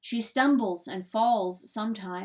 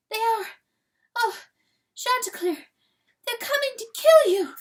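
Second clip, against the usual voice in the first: high, loud, panicked gasps; breathless desperate warning; 14 semitones higher, 8 dB louder than usual. A narrator's reading. Exhausted hen warns rooster of death, desperate and breathless. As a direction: gasp out the warning, breath spent and terrified. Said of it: "the words come out of her like dry rags".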